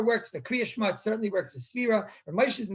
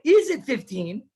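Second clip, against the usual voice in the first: slow, higher question with "fifteen" rising; asking intonation; 4 semitones higher, 5 dB louder, slower than usual. Acted. Teacher replaying the man's query to his fellow, "fifteen" rising as a question. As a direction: draw out "Is it fifteen" and lift the end. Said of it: "He's asking a question".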